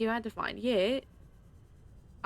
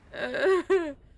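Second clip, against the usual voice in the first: exasperated laugh; 10 semitones higher, 9 dB louder than usual. Real, not acted. Laughing at own mistake.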